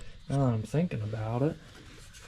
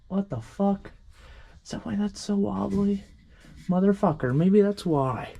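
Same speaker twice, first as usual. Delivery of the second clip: startled discovery; 8 semitones higher than usual. Real, not acted. Finds a loose mirror screw.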